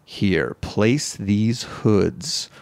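lightly voiced low, slow game host; deadpan instructions, slow and low; low guide voice. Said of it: "And then he goes".

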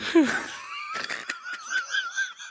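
a high, piercing burst of laughter. Real, not acted. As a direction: shriek with laughter. Big laugh after crying joke.

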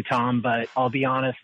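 normal talking voice

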